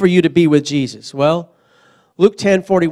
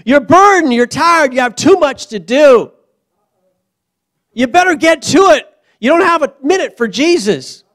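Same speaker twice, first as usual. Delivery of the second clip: loud, raised frantic nagging; mocking busy voice; 7 semitones higher, 5 dB louder than usual. Preacher mocking the harried believer, loud. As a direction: sell the panic of a busy life. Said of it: "That's not how it works".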